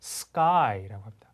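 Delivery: normal talking voice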